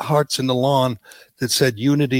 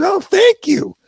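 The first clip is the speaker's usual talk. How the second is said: much higher, gushing reporter voice; mock gushing reporter; 3 dB louder, slower than usual. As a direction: gush like a starstruck reporter. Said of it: "And she says".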